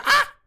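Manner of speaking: short high, loud scream; sudden scream. Shown as a demonstration. Streamer testing the mic on viewers' ears, sudden scream. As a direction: let out a quick piercing test scream. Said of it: "If I was to scream in your ear".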